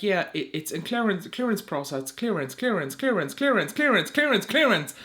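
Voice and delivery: exasperated repetition. Retold or imitated. Creator mimicking endless package notifications, mounting exasperation. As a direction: repeat it mechanically, building as it goes.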